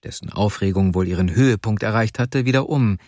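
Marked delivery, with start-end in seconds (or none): none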